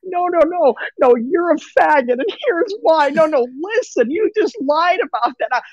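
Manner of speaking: high-pitched mocking whiny protest; mock crying and screaming. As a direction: imitate a frantic, crying rant. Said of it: "they're crying, screaming".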